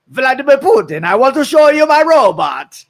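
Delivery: high, loud mock Russian accent; fake Russian accent. Engineer presenting robot to Putin in a mock Russian accent. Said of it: "That's not even Russian".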